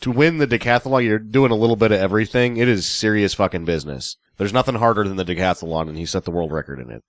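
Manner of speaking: ordinary talk, nothing marked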